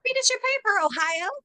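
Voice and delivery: mocking high singsong; high sing-song taunt. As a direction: tease her in a high lilting voice. Taunting the new student.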